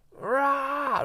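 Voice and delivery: high, loud drawn-out roar; Viking war cry. Shown as a demonstration. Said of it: "Like this Viking".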